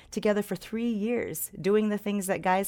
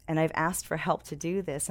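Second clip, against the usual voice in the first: ordinary delivery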